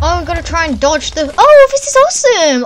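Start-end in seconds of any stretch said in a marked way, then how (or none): 1.4-2.7 much higher, louder delighted cry; thrilled. Real, not acted. Gamer shouting with joy at a newly found game. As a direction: burst out in real delight. Gamer discovers a new minigame.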